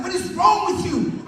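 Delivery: high, slow exasperated scolding; acted-out incredulous reproach; scolding wife. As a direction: imitate an appalled wife telling off her husband. Wife scolding him in a quarrel.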